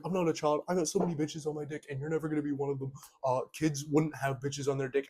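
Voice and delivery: high, fast sneering guy voice; mock brag quoting the guy's imagined reply; mocking bragging guy. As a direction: rattle off the guy's imagined comeback.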